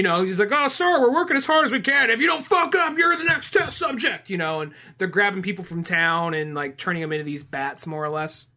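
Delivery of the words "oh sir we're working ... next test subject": high, loud hammed-up lab exchange; mad scientist scene. Scientist and worker over test subjects, hammed-up frenzy. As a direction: act it frantic and over the top. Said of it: "evil mad scientist guy".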